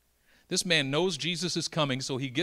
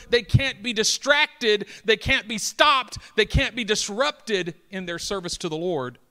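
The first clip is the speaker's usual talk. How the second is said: rising, louder preacher's build; preaching at full force; 7 semitones higher, 8 dB louder than usual. Acted. Preacher driving home the priests' duty, building loud.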